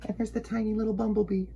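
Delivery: high and fast for something little; tiny excited voice. Acted. Host pointing out the tiny bumblebee, high and fast. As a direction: squeak it out quick and high.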